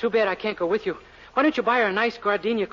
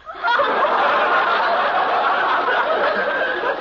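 high breathless cackle of glee; gleeful laugh; 4 dB louder than usual. Acted. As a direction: laugh at his own cruel joke. Boss laughs at humiliating order.

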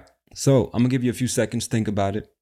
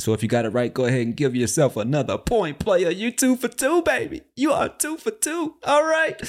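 game-show hype, climbing higher and higher; hyped-up congratulations; 11 semitones higher than usual. Pretend. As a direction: build to a high-pitched cheer for the winner.

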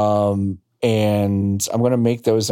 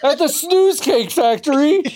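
playful. Acted. Jokey correction, high voice.

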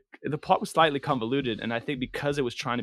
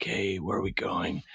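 brooding hero voice; 5 semitones lower than usual. Retold or imitated. One brooding film hero to another, low mock-serious voice. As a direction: play a grim film hero, pitched low. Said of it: "in this tone of voice".

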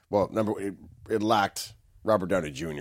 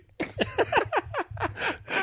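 high laugh; 3 dB quieter than usual. Real, not acted. Laughs at own joke.

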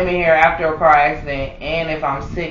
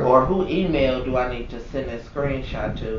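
low, hushed, measured; acted controlled complaint; 6 semitones lower, 7 dB quieter than usual. Employee phoning corporate about the screenshot, quietly indignant. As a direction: drop the voice to a seriously restrained complaint. Acts out calm call to corporate.

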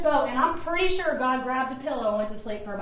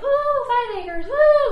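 excited whoop; 9 semitones higher, 5 dB louder than usual. Retold or imitated. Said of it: "I was like".

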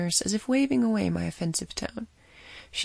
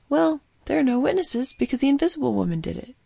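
mocking excuse voice; 7 semitones higher, 5 dB louder than usual. Mocking quote of public excuse.

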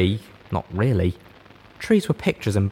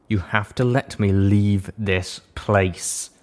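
slow, even, tightly controlled tones; slow measured control; much slower than usual. Acted. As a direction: hold it back, each word measured. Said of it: "in slow, even tones of carefully measured control".

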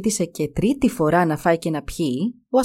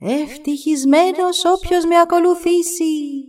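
high, drawn-out cry from a dragon's head; slow, sustained high proclamation; calling out; 9 semitones higher, slower than usual. A narrator's reading. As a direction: call it out high, stretching the words. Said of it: "Το κεφάλι φώναξε".

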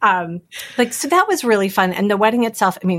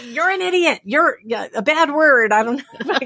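high, half-hearted road-rage yell at the cars; mock New York driver yelling; 7 semitones higher than usual. Daughter imitating her dad yelling at traffic, mock yell. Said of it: "He's like".

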